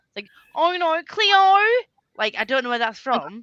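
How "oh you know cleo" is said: high, loud, drawn-out Aussie catchphrase; exaggerated Aussie whine, pitched up; put-on Aussie accent. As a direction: imitate a broad Australian TV line. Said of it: "I don't even know where this is from".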